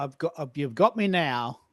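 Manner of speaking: usual speaking voice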